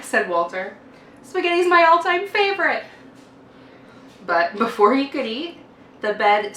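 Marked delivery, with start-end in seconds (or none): 1.2-2.8 put-on high, delighted little boy; high happy boy's voice; excited child voice. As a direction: squeal it like a kid at dinner. Walter delighted by spaghetti.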